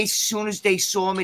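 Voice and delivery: ordinary delivery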